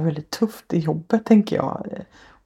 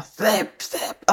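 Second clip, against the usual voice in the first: a mild, breathless hiss of the word släpp; retelling a strangled hiss of släpp; 4 semitones higher than usual. Retold or imitated. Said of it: "Alltså så här väser".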